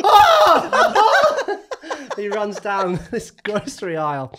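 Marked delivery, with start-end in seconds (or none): 0.0-1.6 high, loud startled yelp; yelp of fright. Retold or imitated. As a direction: cry out as the bird attacks. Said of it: "He's like".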